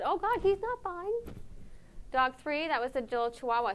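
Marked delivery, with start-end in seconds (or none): 0.0-1.4 high, slow mock dismay; imitating the audience. Crowd seeing the scared terrier, slow high pitying voice. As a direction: play the crowd's pitying gasp, drawn out. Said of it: "Everybody said".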